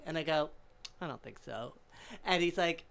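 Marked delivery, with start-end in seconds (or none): 1.0-1.7 low, soft deadpan brush-off; cool refusal. Retold or imitated. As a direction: turn him down quietly, unimpressed. Cool refusal of pushy host.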